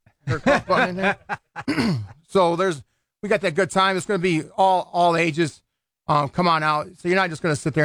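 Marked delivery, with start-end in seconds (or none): 1.2-2.1 clearing the throat with a little laugh. Real, not acted. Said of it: "where's that cough button at".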